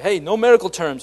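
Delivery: mock shout. Coworkers teasing Stasik about medical terms with a loud mock order. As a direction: ban the big words like a boss. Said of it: "we'd pretend like we knew everything and be like".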